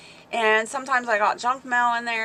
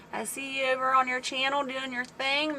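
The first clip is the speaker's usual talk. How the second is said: ordinary talk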